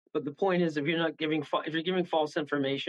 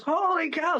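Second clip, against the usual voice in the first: a pitched-up mock gasp of wonder; amazed; 13 semitones higher, slower than usual. Client hearing her reading was right, mock amazed. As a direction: squeal it like a stunned client. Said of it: "And she goes".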